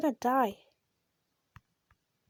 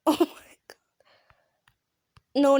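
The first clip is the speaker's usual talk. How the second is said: usual speaking voice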